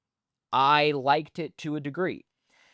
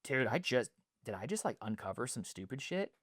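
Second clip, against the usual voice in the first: much softer, half to himself, stunned; quiet aside; 11 dB quieter, faster than usual. Real, not acted. Vlogger stunned by his own realization, murmuring softly to himself. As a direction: murmur it like a sudden private realization.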